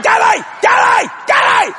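very high, desperate mock screech; frantic calling. Scalded husband calls his wife, frantic high screaming. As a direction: screech her name over and over.

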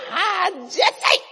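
high-pitched acted reply; playful voice of Jesus. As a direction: answer the greeting in a high voice. Acts Jesus greeting him back.

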